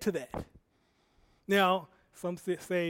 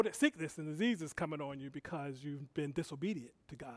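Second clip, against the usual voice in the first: whisper; 4 semitones lower, 8 dB quieter than usual. Real, not acted. Whispered aside.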